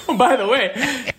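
high-pitched words bubbling out of a laugh. Real, not acted. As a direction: break in while still laughing. Laughing at host's retold line.

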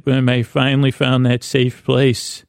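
ordinary talk

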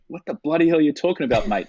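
rapid, incredulous retelling; baffled retort. Retold or imitated. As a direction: fire back a puzzled question, hammed up. Retold baffled reply to crew.

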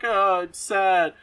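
half-hearted whiny sob; mock crying kid. Kid crying in the cellar, mock sad. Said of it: "one of the kids starts crying".

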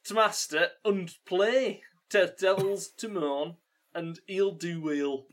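stumbling dialect read, part Geordie, mostly Yorkshire; Yorkshire-tinged Geordie attempt. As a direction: plough through a badly held Geordie accent. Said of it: "that was more Yorkshire than it was Geordie".

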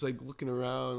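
ordinary talk

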